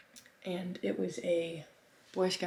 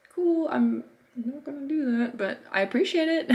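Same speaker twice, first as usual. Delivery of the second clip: mock polite; 8 semitones higher, 6 dB louder than usual. Imagined reply to the kids.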